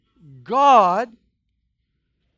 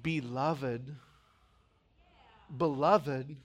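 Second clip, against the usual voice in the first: tender address; 9 semitones lower, 6 dB quieter, much slower than usual. Shown as a demonstration. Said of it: "this passage starts".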